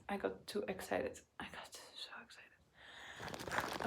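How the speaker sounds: voiceless, fading whisper; spent whisper. Real, not acted. Winded after getting too excited.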